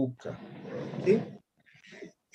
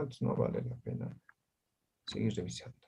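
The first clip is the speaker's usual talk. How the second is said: muttered aside; 5 semitones lower, 13 dB quieter than usual. Real, not acted. Unvoiced low aside.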